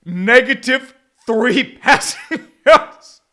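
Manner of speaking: slow incredulous laugh through it; laughing disbelief. Real, not acted. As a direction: drag it out laughing at the stat. Laughing at terrible stat.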